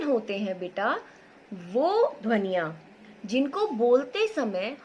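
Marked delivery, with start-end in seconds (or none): none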